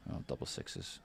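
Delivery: softly whispered aside. Real, not acted. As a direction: breathe it out under your breath. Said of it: "This is me trying to whisper".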